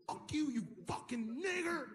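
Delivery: high, slowed, snarling mock insult; slur shout. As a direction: spit the insult from the car. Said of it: "He said".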